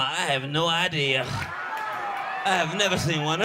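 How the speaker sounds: slow, dragged-out flat denial; slow deadpan. Retold or imitated. Wife answering the son, very slow deadpan. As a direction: draw it out like a tired wife.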